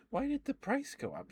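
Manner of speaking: ordinary delivery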